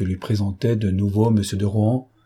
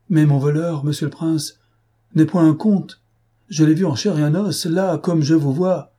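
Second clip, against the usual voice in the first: insistent and agitated; 6 semitones higher, 3 dB louder than usual. Acted. Gachard insists thief is real.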